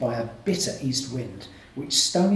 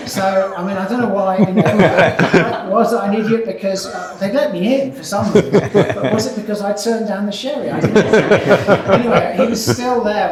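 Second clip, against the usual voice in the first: louder, chuckling through the words; laughing while talking; 5 semitones higher, 10 dB louder than usual. Real, not acted. Speaker wondering about the sherry, laughing throughout.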